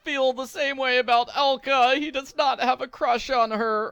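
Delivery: high, loud hammed-up wail of heartbreak; mock despair. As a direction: melodramatic fake sobbing over a crush. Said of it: "it's, it's just tragic".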